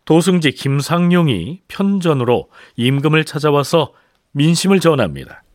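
ordinary talk

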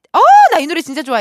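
gushing over a favourite song; excited. Real, not acted. DJ gushing to listeners about her favourite song, excited and fast.

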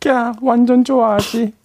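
put-on high, slow gushing girl; girl's voice. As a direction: coo like a pleased girl. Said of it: "여자 목소리를 낼 때".

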